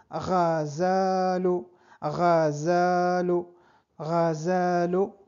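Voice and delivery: ghazalu said slowly, throaty gh, each syllable clear; Arabic word drill. Shown as a demonstration. Teacher drills ghazalu slowly for beginners. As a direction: stretch out ghazalu gently. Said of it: "debajo te".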